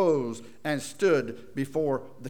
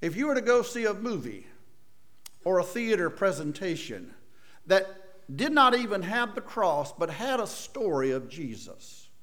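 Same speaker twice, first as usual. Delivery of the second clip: ordinary talk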